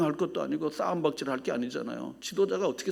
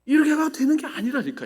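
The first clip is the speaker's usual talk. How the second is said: exasperated insistence; 10 semitones higher, 5 dB louder than usual. Real, not acted. Preacher insists.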